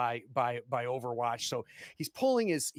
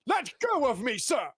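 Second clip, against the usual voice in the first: very high, loud panicked protest; a pinned courier shouting to be freed; panicked gentleman; 14 semitones higher, 5 dB louder than usual. Acted. Courier yelling at the man pinning him down, very high.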